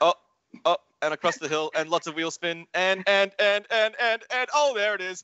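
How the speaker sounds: rising, building excitement; excited. Real, not acted. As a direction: pitch climbing toward the crash. Crash coming in live commentary.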